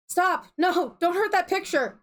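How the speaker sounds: high, urgent acted cry; desperate plea. Kane begging the insects off her picture, desperate.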